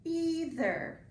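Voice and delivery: "Either" with a drawn-out "ee" first; long E sound. Shown as a demonstration. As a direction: model "Either" as EE-ther. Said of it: "What makes that E sound".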